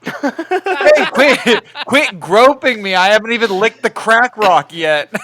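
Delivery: high, loud objection through laughter; mock loud protest. Factory visitor telling someone to quit groping, mock outraged. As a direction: protest loudly, half laughing.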